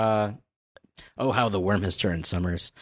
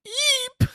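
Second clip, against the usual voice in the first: high, stretched cartoon squeak; imitated squeak of fright; scared yelp. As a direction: let out a mock-frightened little yelp. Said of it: "Summers has this really scared".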